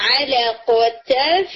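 علقتف with the n hidden, teeth on lip, very slow; ikhfa demo. Shown as a demonstration. Teacher showing the ikhfa position, slow nasal. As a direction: stretch علقتف slowly with a nasal hum. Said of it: "بالکل یہ position ہوگی".

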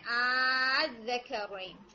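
आज़करैन with a drawn-out first vowel, recited slowly; elongated madd recitation. Shown as a demonstration. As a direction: hold the opening आ of आज़करैन very long. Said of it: "six हरकत मद लाजिम के according".

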